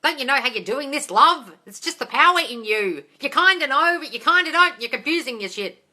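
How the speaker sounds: high, loud, teasing scold; mock lecturing Oprah. Telling Oprah off in mock scolding voice. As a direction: talk down to Oprah like a know-it-all. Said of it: "And you're like".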